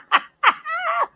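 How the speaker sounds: high, loud burst of laughter; cracking up at the clip. Real, not acted. Reacting to a fight clip.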